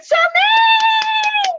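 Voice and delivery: squealing her name high and loud; shrieking greeting; excited shout. Real, not acted. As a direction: call out to a friend in delight.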